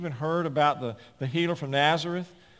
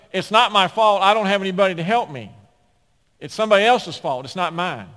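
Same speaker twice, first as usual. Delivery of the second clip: loud, higher-pitched protest of innocence; blame-shifting excuse; 5 semitones higher, 8 dB louder than usual. Acted. Crippled man excuses himself to Jesus, loudly protesting. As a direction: plead the excuse, easing off at the end.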